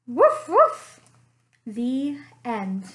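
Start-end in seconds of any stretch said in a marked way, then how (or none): 0.1-1.0 high, loud playful woofs; dog barking. Acted. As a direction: bark it out like a happy dog. Dog narrator barks at end.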